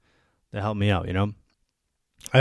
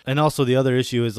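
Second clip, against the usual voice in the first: ordinary talk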